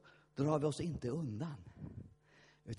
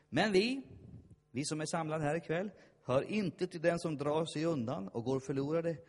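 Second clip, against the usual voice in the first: soaring shouted preaching, each vinner a cry; preaching at full force; 7 semitones higher, 11 dB louder, slower than usual. Real, not acted. Pastor rallying the congregation, shouting at full force.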